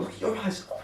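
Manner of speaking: lightly voiced cooing compliment; admiring older woman. Sister voiced admiring him.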